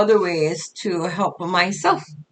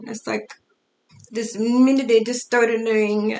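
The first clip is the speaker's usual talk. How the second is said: usual speaking voice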